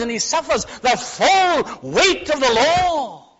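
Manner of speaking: high, drawn-out, emphatic pulpit delivery; impassioned preaching. Acted. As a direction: build to the full weight of it. Preaching at full force as Christ.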